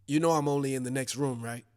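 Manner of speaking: low, soft, quick deadpan; calm. Acted. Joe answering Rita's yell from the next room, calm and low. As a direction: say it calmly under your breath. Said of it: "calmly say".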